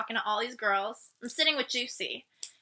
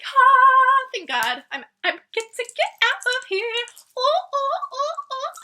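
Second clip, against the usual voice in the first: much higher, louder happy cries; relieved joy; 14 semitones higher, 7 dB louder than usual. Retold or imitated. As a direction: let the relief burst out in squeals. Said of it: "I was like".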